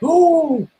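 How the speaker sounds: high, wide-eyed ooh; childlike ooh. Pretend. Child amazed by a new product, high ooh. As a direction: coo like a child seeing something new.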